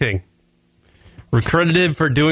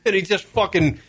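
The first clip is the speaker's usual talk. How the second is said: ordinary delivery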